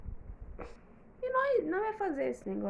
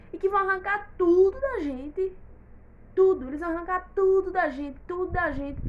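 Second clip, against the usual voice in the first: protesto indignado, mais alto e agudo; revoltada; 5 semitones higher, 7 dB louder than usual. Acted. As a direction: proteste com força contra a perda de tudo. Revolta por perderem tudo. (indignação exaltada, voz elevada)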